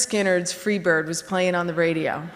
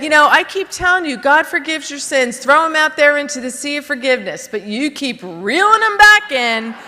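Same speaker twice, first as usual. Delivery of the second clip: firm lecture; 5 semitones higher, 5 dB louder than usual. Retold or imitated. Priest lectures her firmly.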